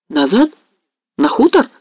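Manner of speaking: alarmed cry, pitched high; cried out. A narrator's reading. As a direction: exclaim in sudden alarm. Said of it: "вскрикнул Мазепа".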